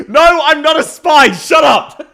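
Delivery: high, loud protest through laughter; laughing shout. Real, not acted. Streamer fending off spy accusations from chat, loud laughing denial.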